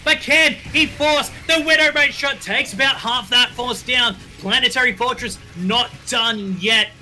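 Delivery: fast, rising esports casting; excited play-by-play. Acted. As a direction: call the fight as it builds.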